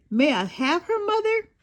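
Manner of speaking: a hint of a child begging, pitch rising on Mother; pleading little girl. A narrator's reading. Girl begs her mother for the bunny, pleading. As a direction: ask sweetly, hopeful lift at the end.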